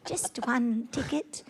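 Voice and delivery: soft, slow pleading orphan; poor orphan voice. Pretend. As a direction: beg quietly like a starving street orphan. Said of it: "do a poor orphan voice".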